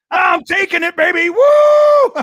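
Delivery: high, loud whooping shout; pumped fan cheering; hyped viewer. Retold or imitated. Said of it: "Knobcraft says".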